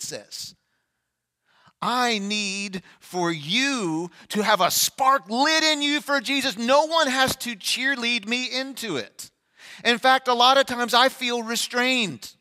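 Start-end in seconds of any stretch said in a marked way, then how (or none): 1.8-12.3 fervent preacher pouring out his heart; full-force preaching. Acted. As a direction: stretch each word out from the pulpit.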